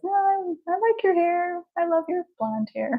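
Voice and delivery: lightly mimicked mom, high and sweet; mom's voice. Daughter imitating her late mom reassuring her, high. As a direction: play her mother, pitched well up. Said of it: "she'd probably say".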